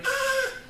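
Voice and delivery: high strangled gasp of a shark choking; mock choking gasp. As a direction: gasp high, like something stuck in the throat. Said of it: "he was going".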